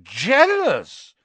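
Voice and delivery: high, loud, incredulous question; scornful echo. A narrator's reading. Malfoy answering Colin's accusation, scornfully incredulous. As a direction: throw the word back in disbelief.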